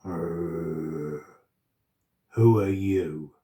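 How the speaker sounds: low growling monster voice; deep growling voice. A narrator's reading. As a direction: growl it out slowly from the dark. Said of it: "a deep growling voice said".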